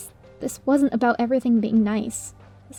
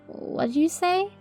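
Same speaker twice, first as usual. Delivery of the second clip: shocked quiet; 6 semitones higher, 5 dB quieter, slower than usual. Acted. Shocked question.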